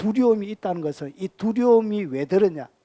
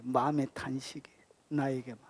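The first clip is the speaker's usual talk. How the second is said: hushed, breathy sigh of complaint; soft, lower whispered grievance; whispered lament; 7 semitones lower, 11 dB quieter than usual. Acted. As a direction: whisper it like a secret groan to God. Quiet sigh of inner lament.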